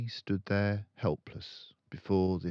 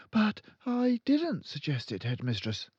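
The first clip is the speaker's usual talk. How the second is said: put-on high, polite young teacher; high pleading teacher; timid teacher; 13 semitones higher, 5 dB louder than usual. A narrator's reading. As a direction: stand firm though nervous. Miss Honey objects.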